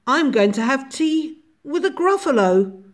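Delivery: mouse. A narrator's reading. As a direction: politely turn down the invitation as the mouse. Mouse declines owl's invitation in story.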